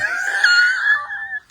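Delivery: a high, shouted squeal of laughter; shrieking laugh. Real, not acted. Squealing laugh while gushing to viewers about a man. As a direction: shriek with laughter at the thought of him.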